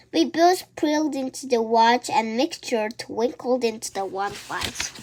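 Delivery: ordinary delivery, nothing marked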